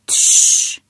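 gentle unvoiced hushing; soothing shush. A narrator's reading. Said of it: "успокоил Эдвард".

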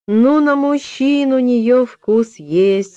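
put-on high, slow knowing child; a little girl agreeing wisely. A narrator's reading. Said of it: "согласилась маленькая Аня".

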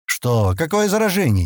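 puzzled, pitch jumping on the question; mildly acted confusion. A narrator's reading. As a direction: ask in bewilderment. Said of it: "не понял Стэн".

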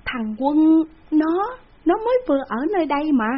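high, loud, incredulous stammer; stunned disbelief. A narrator's reading. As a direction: blurt it out, unable to believe it. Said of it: "không tin vào lỗ tai của mình". (sửng sốt không tin nổi, cao và to)

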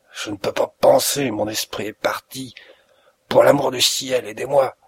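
husky, half-whispered begging; a sick man pleading for his life; breathless plea. Acted. Dying Holmes begging the visitor, husky half-whispered plea.

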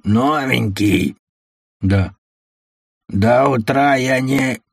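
slow, lightly voiced smoker's rasp; a hint of a creaky, drawn-out voice; grating voice. A narrator's reading. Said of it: "прокуренный скрипучий голос".

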